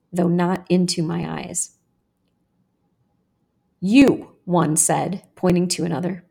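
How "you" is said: louder, punched single word; boy's urging. A narrator's reading. One boy pointing to another, urging. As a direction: goad him with one push.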